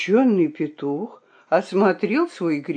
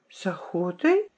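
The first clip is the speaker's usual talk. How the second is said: puzzled question; 6 dB quieter than usual. Acted. Fox repeats, questioning.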